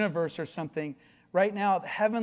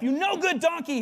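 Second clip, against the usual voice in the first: higher, hammed-up scolding of the donkey; mock angry yell; 9 semitones higher than usual. Balaam scolding his stubborn donkey, hammed-up and high. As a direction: bark it at the donkey in mock fury. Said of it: "He probably used a different word".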